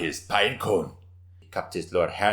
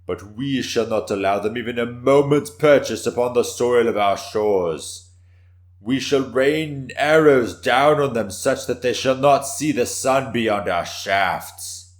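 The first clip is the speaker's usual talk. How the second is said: rising to fever pitch; 6 semitones higher, 10 dB louder than usual. Acted. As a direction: build the vow toward a roar. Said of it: "His voice built to a fever pitch".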